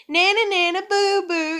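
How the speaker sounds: high sing-song playground teasing; mock a schoolkid taunting; childish taunt. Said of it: "the kid in school".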